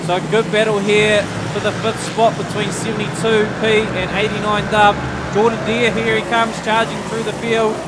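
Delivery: brisk live race call; motorsport commentator. Acted. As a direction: call the heat lap by lap.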